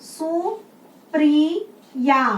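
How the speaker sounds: very slow Supriya, stretched; drawn out. Shown as a demonstration. Teacher slowly stretching a word for the class. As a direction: spell it out slowly, syllable by syllable. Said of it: "teacher sounding out word slowly".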